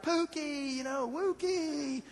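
high, slow cooing; sweet-talking newborn kittens. Retold or imitated. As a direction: coo at the kittens slowly. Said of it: "we're like".

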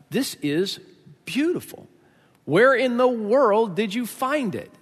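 admiring, pitch lifted on the praise; delighted wonder. Acted. Woman receiving a seashell as a gift, delighted wonder. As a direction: marvel slowly at a precious gift.

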